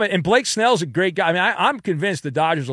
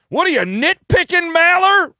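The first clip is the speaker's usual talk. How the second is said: high, loud indignant heckler; heckling critic; 8 dB louder than usual. Acted. Listener objecting to the host's criticism, loud heckle. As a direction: yell it like an outraged caller.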